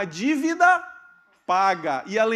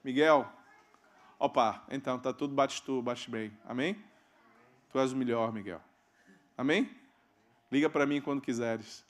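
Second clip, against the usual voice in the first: Ronaldo talking; 7 semitones lower, 3 dB quieter than usual. Retold or imitated. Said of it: "Ele fala assim com você".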